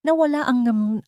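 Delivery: ordinary delivery, nothing marked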